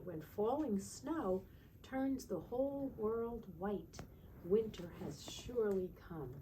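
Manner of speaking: slow, storybook read-aloud; reading to children. Acted. Teacher reading a winter book to the class, storybook voice. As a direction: read it like a bedtime picture book.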